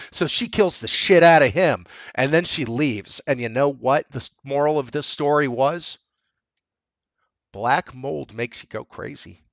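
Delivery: ordinary talk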